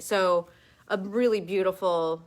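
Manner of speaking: usual speaking voice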